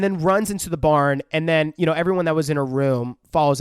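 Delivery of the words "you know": ordinary talk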